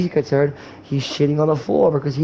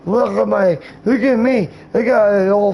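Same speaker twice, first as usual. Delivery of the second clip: a light mock of a bragging show-off, higher; mock braggart voice; 4 semitones higher than usual. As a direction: tease him as a smug bragger, a little higher. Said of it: "and you sound like this".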